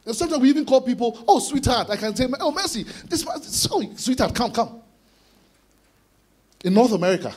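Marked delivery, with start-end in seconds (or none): 1.3-1.9 high, effusive term of affection; expressive endearment. Acted. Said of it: "we're very emotion expressive". 2.4-4.8 expressive fondness. Acted. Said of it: "we're very emotion expressive".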